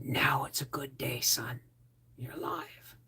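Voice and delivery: soft, hushed comfort; tender whisper. Retold or imitated. Sergeant comforting the young survivor in a tender whisper. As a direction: whisper it gently like a hand on the shoulder.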